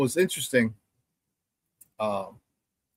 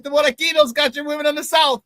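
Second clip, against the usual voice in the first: very high-pitched re-enacted jab; taunting comeback; 10 semitones higher than usual. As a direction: needle the teammates in a raised, excited voice. Retold taunt that angered teammates.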